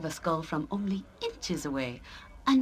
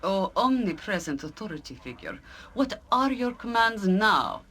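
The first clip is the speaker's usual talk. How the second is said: sarcastic; 3 dB louder than usual. Acted. Jaheira mocking her party leader's authority, sarcastic. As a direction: address the leader with exaggerated, sarcastic obedience. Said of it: "there's a level of sarcasm in your tone".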